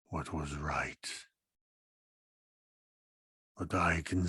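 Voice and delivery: grind it out like shifting stone; low gravel vigilante growl. Acted. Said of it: "like heavy stone against stone".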